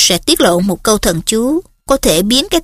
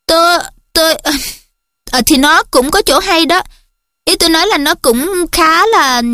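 flustered, stumbling, raised pitch; embarrassed, tripping over words; stammering; 7 semitones higher than usual. Acted. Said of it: "quê độ, ấp úng".

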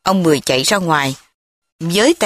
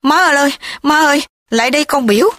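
howling; 7 semitones higher, faster than usual. A narrator's reading. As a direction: bawl at his mother rudely. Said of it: "cậu cẩu gào lên".

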